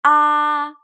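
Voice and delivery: "Ah" held high and level; first tone ah. Shown as a demonstration. Teacher repeats first tone, high and level. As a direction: keep "Ah" high and flat. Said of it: "high and flat".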